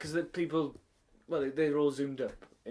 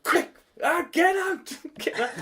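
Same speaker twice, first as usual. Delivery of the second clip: higher, louder laughing shout; mock shout; 8 dB louder, slower than usual. Teacher retelling shooing a bird, high laughing shout. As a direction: shoo them out, laughing. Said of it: "So I was like".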